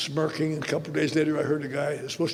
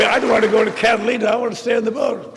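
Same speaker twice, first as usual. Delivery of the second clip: eager pleading shout; 6 semitones higher, 8 dB louder than usual. Retold or imitated. Newcomer begging to stay aboard.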